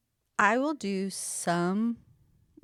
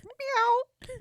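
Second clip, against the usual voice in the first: high silly meow sound effect; cat meow. Pretend. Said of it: "I'm gonna just start doing sound effects".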